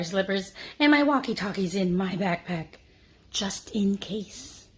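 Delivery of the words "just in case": breathy secret whisper. A narrator's reading. Narrator whispering a secret to the listener. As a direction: hush it like a secret.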